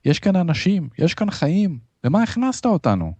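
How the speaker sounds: high, distressed pleading; breaking voice. A narrator's reading. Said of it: "והפעם הקול שלו כבר נשבר". (תחינה בקול גבוה ונשבר)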